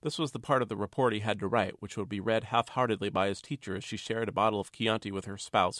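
usual speaking voice

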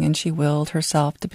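ordinary delivery

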